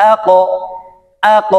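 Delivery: "আ ক" with the ক bounced upward; upward qalqala. Shown as a demonstration. Teacher repeating "আ ক" for learners, upward qalqala. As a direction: release the ক of "আ ক" in a rounded echo. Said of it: "উপরের দিকে ধাবিত করে".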